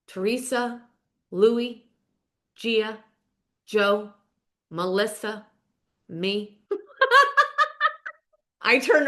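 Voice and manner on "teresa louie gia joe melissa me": "Teresa, Louie, Gia, Joe, Melissa, me", each name set apart by a long pause; deliberate roll call. Real, not acted. Host lists who is in the club, "Teresa" to "me" in deliberate, separated names. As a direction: count off "Teresa", "Louie", "Gia", one at a time, letting each hang. Listing names for dramatic effect.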